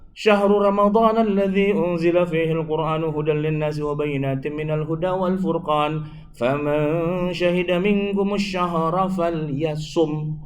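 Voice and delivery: slow, chanted Arabic recitation; Quran recitation. Acted. Preacher reciting the fasting verse to the audience, slow chant.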